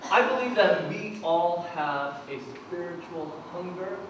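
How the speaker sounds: ordinary talk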